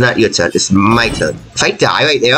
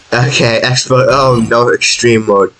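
ordinary talk, nothing marked

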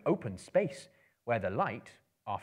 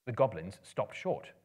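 usual speaking voice

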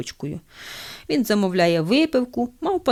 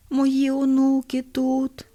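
very slow, higher feeble question; weak dying old man; 5 semitones higher, much slower than usual. Acted. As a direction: drag each word out from the deathbed. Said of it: "слабким голосом запитує".

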